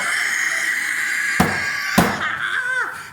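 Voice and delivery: big breathy burst of laughter; loud laugh. Real, not acted. Laughing hard at the memory, loud. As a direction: crack up loudly.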